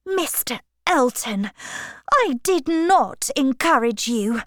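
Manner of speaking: mildly icy rebuff; understated chilly reproof; cold. A narrator's reading. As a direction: hold him off with cool firmness. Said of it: "said Emma coldly".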